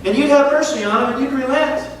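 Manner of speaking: lightly acted frustrated complaint to God; mock angry Jonah. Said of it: "he's so mad at God, he says".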